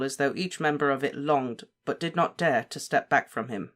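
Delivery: plain reading voice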